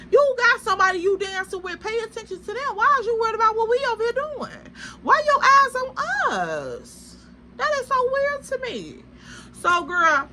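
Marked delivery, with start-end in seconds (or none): 0.1-9.0 high, fast teasing scold; mock scold. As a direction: needle her in a high voice. Scolding the staring girl.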